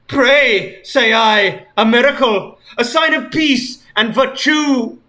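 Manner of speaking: high, loud archaic declamation; hammed-up mock-archaic villain speech; theatrical villain Sonic. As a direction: proclaim it like a mad prophet.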